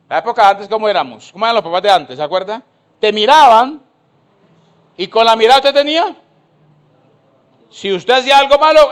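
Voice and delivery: loud, high preaching; fiery preaching. Acted. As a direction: preach it to the crowd with fire. Preaching at full force.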